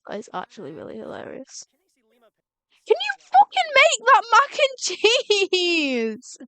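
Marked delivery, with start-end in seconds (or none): 2.9-6.4 exasperated. Real, not acted. Yelling at Sim about dinner.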